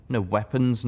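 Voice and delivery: usual speaking voice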